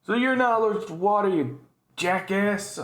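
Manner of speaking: high, exasperated put-down; exasperated scolding. Real, not acted. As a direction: scold an absent fool, drawing out the insult. Scolds the patient from the story.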